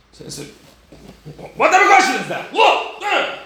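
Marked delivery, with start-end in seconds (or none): 1.6-3.4 high, loud acted indignation; hammed-up exasperated yell; incredulous outburst. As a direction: shout it as if the question is absurd. Acted indignant reply of the rabbis.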